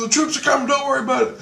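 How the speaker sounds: high-pitched excited quote; urgent reassurance. Retold or imitated. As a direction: imitate someone hurriedly reassuring, voice up high. Voices Christ urging him on.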